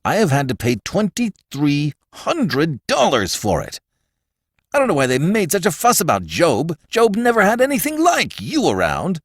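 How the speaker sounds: high-pitched, aggrieved complaint, rising as it goes; exasperated tirade. A narrator's reading. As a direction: rail at the person to blame. Brewster rails at his son-in-law.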